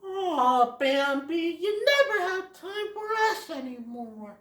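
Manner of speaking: high, sing-song complaint; whiny Thumper. Retold or imitated. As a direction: whine it like a pouting bunny. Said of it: "Thumper's all like".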